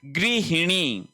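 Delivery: गृहिणी said slowly, gri-hi-ni; slow, careful. Shown as a demonstration. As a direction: spell out गृहिणी clearly for students. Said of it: "ध्यान रख लेना". (गृहिणी धीरे, साफ़)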